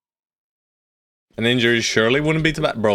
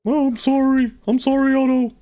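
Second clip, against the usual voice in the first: much higher put-on character voice; Chaucer voice. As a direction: squeak out an apology as the character. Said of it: "That's my Chaucer voice".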